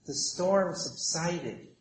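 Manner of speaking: fading to near silence; hushed calm. A narrator's reading. Telling how the storm subsided, hushed and fading. Storm dying away in story.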